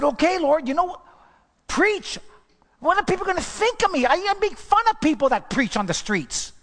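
pitched high, pleading and worried to the Lord; anxious pleading. Retold or imitated. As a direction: retell the panic of being asked to preach. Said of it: "And so I said".

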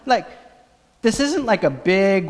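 usual speaking voice

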